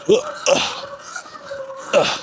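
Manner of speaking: strained high grunts mid-jump; effort grunts. Real, not acted. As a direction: grunt through the landing.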